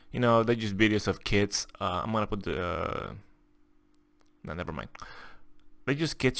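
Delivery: ordinary talk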